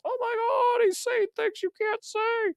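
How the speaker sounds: very high falsetto, soft and slowing down; mock shocked gasp. Mocking the audience that gasps at a daring joke. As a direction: squeak it like a scandalised prude.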